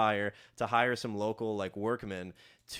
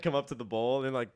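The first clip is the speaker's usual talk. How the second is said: ordinary talk, nothing marked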